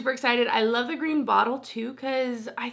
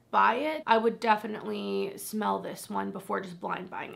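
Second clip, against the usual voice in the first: usual speaking voice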